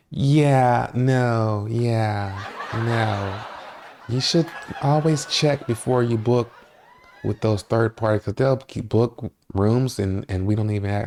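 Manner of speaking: hammed-up clerk lecturing with a giggle; mock desk clerk. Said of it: "She's like".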